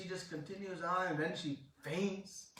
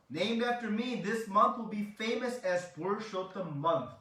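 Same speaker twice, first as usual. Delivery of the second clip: loud, raised-pitch naming decree; divine proclamation; 6 semitones higher, 7 dB louder than usual. Retold or imitated. Krishna gives the month his name, loud proclamation. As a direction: announce the new name with grand emphasis.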